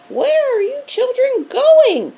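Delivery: high, loud sing-song teacher voice; creepy strict teacher calling; teacher calling after fleeing students; monster teacher. A narrator's reading. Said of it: "asked Miss Birnbaum".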